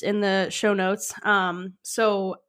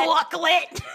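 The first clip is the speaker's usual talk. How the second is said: mock shout; 8 semitones higher than usual.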